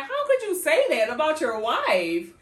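loud, high, outraged question; a friend loudly scolding the husband for calling his wife ugly; indignant outburst. Retold or imitated. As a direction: call him out, loud and shocked. Her own outraged reaction, acted out.

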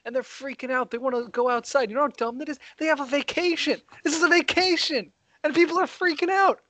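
worked up, pushing the pitch up line by line; getting angry. Real, not acted. Ranting to his co-host about people at home, getting angry. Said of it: "I'm getting angry now".

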